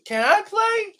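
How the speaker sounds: very high put-on girl voice; squeaky mock girlish request; mimicking a girl. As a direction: ask it in a falsetto, like an eager girlfriend. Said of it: "She was".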